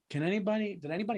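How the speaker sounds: hushed, tense complaint; soft, strained demand for service; whisper yell. Retold or imitated. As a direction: complain under your breath with pressure behind it. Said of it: "You whisper yell".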